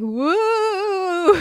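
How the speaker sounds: high, drawn-out mock spooky woo; zombie wail. Sibling mimicking the bed prank, mock zombie wail. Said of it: "slowly rose up like a zombie".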